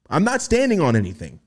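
ordinary talk, nothing marked

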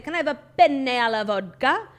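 exaggerated Italian on "penne alla vodka"; overdone Italian accent. Shown as a demonstration. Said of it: "I would never say".

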